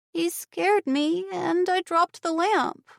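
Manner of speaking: put-on high, slow child's voice; scared little girl. A narrator's reading. Aurora confessing to her aunt, high slow child voice. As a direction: confess it like a frightened kid.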